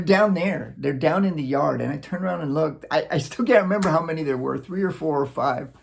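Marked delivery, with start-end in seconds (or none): none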